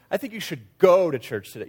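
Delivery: "go" stretched out and loud; stress on GO. Shown as a demonstration. Preacher showing the emphasis game, stress on "go". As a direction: hit "go" hard. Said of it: "change the emphasis of the words".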